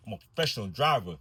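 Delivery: ordinary talk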